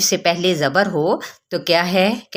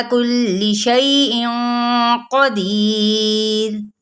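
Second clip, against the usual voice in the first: slow, melodic tajweed chant, higher pitch; Quran recitation; 4 semitones higher, much slower than usual. Shown as a demonstration. Teacher reciting verses for students, slow melodic chant. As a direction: recite each verse slowly with drawn-out vowels.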